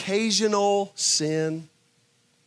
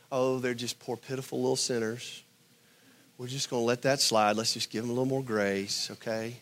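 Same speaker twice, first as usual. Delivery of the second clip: indulgent pushover; 6 semitones lower, 5 dB quieter than usual. Retold or imitated. Preacher mocking a soft lenient God, low and cooing. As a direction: lower and softer, coddling tone.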